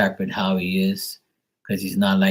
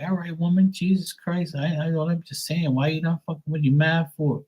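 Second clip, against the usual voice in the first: higher-pitched exasperated comeback; high, put-upon protest; 8 semitones higher than usual. Retold or imitated. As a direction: plead with a moody mom in exasperation, pitched up. Exasperated reply to mother.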